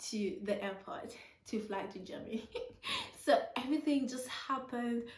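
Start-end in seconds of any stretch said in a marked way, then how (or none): 2.5-3.2 high giggle. Real, not acted. Burst of laughter.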